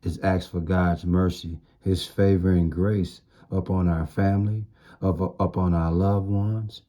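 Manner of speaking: normal talking voice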